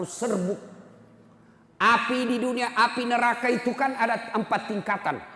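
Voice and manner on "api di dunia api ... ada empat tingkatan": loud, high, rising preacher's warning; preaching at full force. Acted. Preacher warns the congregation about hellfire, loud and high. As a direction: thunder it out to the congregation. Fiery sermon on hell.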